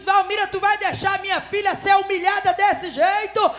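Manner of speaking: loud, high commanding rebuke; voice of Jesus. Retold or imitated. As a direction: call her out with divine urgency. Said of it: "Aí Jesus disse". (voz de Jesus repreendendo alto)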